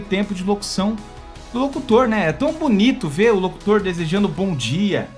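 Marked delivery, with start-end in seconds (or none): none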